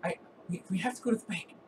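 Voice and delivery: high, panicky stammering voice; cornered victim caving in, high-pitched; freaking-out dwarf. Acted. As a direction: plead frantically, tripping over the words. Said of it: "he's, like, freaking out".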